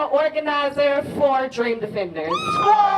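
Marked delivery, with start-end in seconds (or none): 2.3-3.0 high shouted woo; rally woo cry; excited woo. Real, not acted. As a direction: whoop to hype the crowd. Crowd cheer.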